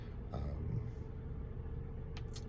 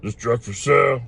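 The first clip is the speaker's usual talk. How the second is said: big customer voice; 4 semitones higher, 7 dB louder than usual. Retold or imitated. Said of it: "he's like".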